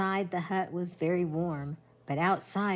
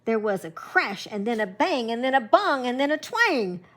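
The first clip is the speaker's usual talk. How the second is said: louder and higher with each sound; grandma reading the crashing sounds, getting louder; building noise; 4 semitones higher, 7 dB louder than usual. A narrator's reading. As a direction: build it up bang by bang. Noises building as bear arrives.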